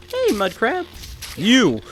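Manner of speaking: high-pitched singsong hey; high mock greeting. Real, not acted. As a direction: greet the crab in a squeaky voice. Calling out to a crab.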